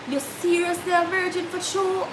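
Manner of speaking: high, soft male voice, doubting; boy's doubtful question. Retold or imitated. Boy asking the crying girl, soft and doubtful. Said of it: "him look on him and say".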